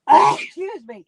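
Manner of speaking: slow, strained after a cough; hoarse after coughing. Real, not acted. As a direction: catch your breath and apologise. Right after a coughing fit.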